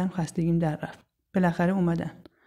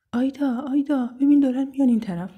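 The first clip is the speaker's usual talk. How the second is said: high, urgent calling out; alarmed warning; 7 semitones higher, 4 dB louder than usual. Acted. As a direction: get a friend's attention, danger approaching. Friend warns of approaching boys. (صدا زدن مضطرب و هشداردهنده)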